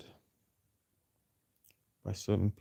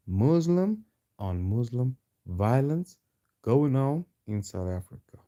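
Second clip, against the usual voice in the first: slow, broken into deliberate chunks with pauses; slow emphatic repeat. Real, not acted. Host driving the point home to followers, slow and emphatic. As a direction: spell it out phrase by phrase, drawn out.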